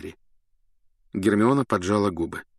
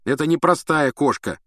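insistent; 6 semitones higher, 4 dB louder than usual. A narrator's reading. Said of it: "не унимался Рон".

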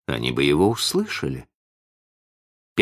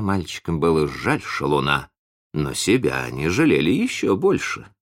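plain reading voice

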